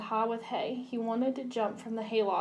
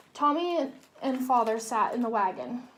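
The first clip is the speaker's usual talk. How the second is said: ordinary delivery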